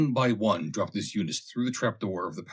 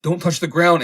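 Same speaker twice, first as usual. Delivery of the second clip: higher and much louder, a sharp urgent shout; warning shout; 9 semitones higher, 9 dB louder than usual. A narrator's reading. Fosdick warns Stetzel not to touch the ground, shouting. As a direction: yell it out to stop him in time. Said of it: "shouted Mister Fosdick warningly".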